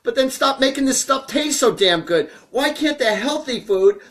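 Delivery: worked-up, high-voiced complaint; exasperated rant. Real, not acted. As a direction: plead your case in mock outrage. Comic rant about tasty unhealthy food.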